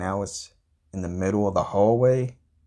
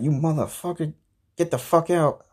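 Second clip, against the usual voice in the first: high-pitched imitation of an angry girlfriend; mad girlfriend; 8 semitones higher than usual. Girlfriend throwing him out over the dog, mock anger in a high voice. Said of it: "she was mad at me".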